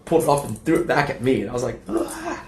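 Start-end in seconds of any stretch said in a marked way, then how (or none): 1.9-2.4 very high strained "aah"; squealed cry, not at full volume; mock yell. Re-enacting the smoothie being thrown back, high mock yell.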